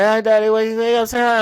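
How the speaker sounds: put-on high, loud, excited child voice; imitating his kids calling daddy on the phone; kids' voice. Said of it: "They're like".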